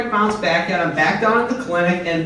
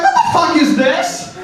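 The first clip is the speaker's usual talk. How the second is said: higher, louder, appalled question; mock disbelief; 8 semitones higher, 5 dB louder than usual. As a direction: act appalled at the tiny car. Retold shock seeing the car.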